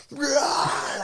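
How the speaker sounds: high mock shriek; freaked-out cry. Imitating the woman freaking out as he lifts her, high cry. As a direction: blurt out a startled, high cry. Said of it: "like, freaking out".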